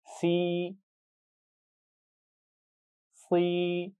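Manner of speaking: सी drawn out, the second one heavier; long sibilant ee. Shown as a demonstration. Teacher drills the s letters with students: held सी. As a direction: stretch each सी, thicken the second. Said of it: "सौद की आवाज़ को आप थोड़ा सा मोटा करेंगे".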